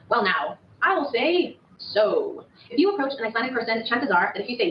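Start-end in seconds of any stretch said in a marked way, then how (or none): 0.1-2.4 slow drawl. Shown as a demonstration. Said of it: "interchangeable with".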